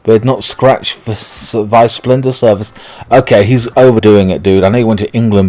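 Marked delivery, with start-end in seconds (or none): none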